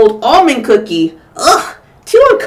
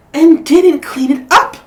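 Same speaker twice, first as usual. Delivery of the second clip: complaining Missus Chan; 7 semitones higher, 6 dB louder than usual. Acted. Mother complaining in story.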